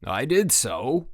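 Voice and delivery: slow, dug-in contradiction; insistent retort. A narrator's reading. Frank arguing with Jack over the tag, insistent. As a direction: draw each word out stubbornly.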